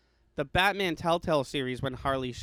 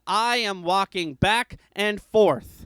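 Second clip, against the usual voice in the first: higher, louder and very slow mock line; imitating Harley Quinn; 5 semitones higher, 5 dB louder, much slower than usual. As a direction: stretch each word out slowly, pitched up and loud. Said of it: "and she goes".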